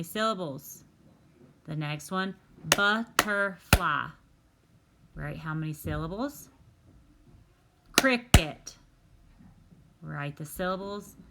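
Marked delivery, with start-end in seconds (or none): 2.8-4.1 butterfly drawn out slowly, each syllable split and louder; a teacher slowly chopping butterfly into beats; syllable-clapped word. Shown as a demonstration. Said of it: "We're gonna clap them". 7.9-8.7 Cricket called out loud and higher, both syllables punched; a teacher calling out cricket in two beats; syllable-clapped word. Shown as a demonstration. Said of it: "We're gonna clap them".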